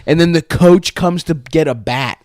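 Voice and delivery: ordinary talk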